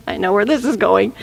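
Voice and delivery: see through the ploy, quietly; bouncer. Acted. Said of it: "The bouncer's like".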